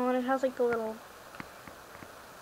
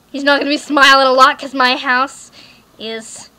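mock sinister threat; 11 dB louder than usual. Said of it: "Uh, I'm kidding".

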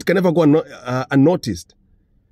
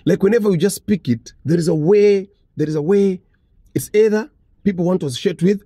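usual speaking voice

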